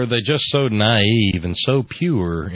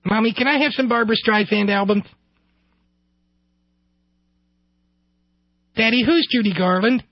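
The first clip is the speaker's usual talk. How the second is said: put-on very high child voice; little boy; 12 semitones higher than usual. Son questions his parents, little-boy voice. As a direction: ask like a curious small child.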